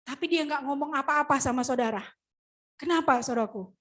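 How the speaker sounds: ordinary talk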